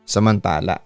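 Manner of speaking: ordinary delivery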